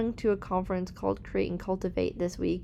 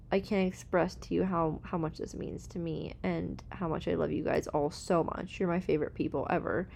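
tender thanks; 4 semitones lower, 6 dB quieter than usual. Real, not acted. Tearful thanks to listeners.

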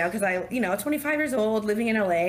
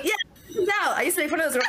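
higher, laughing, excited; amused; 8 semitones higher than usual. Real, not acted. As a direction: bounce up with laughter. Laughing at the story.

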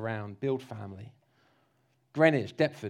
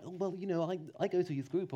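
softer, hesitant fumbling; awkward mumble; 7 dB quieter, faster than usual. Retold or imitated. Preacher imitating a teen's awkward mumbled witness. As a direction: stammer it out self-consciously. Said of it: "awkward words".

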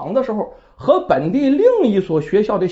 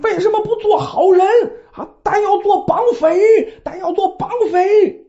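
put-on a sobbing kid shouting accusations, pitched high; crying child's shout; 9 semitones higher than usual. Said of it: "咣咣咣咣大喊".

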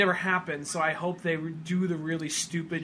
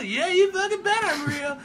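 much higher, louder cocky cartoon voice; cocky Sonic; 14 semitones higher, 6 dB louder than usual. Retelling the pitch, high cocky Sonic. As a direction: brag like a cartoon hedgehog. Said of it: "Sonic answers the kid".

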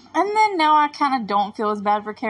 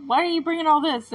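quick, higher-pitched mock bafflement; retold incredulous question; 7 semitones higher, 4 dB louder, faster than usual. Boy questioning his friend's snacks, incredulous.

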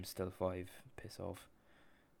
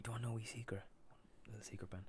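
hushed, secretive whisper; playful conspiratorial hush; 3 semitones higher, 8 dB quieter, much faster than usual. Pretend. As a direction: lean in and share a secret. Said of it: "why are we whispering?".

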